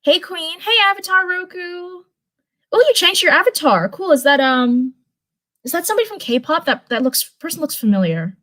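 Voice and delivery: ordinary delivery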